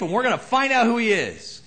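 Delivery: normal talking voice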